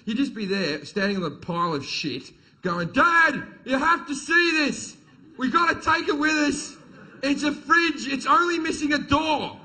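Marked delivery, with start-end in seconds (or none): 2.9-9.6 put-on high, loud childish excitement; excited little kid. Kid calls dad over at the junkyard, high and thrilled.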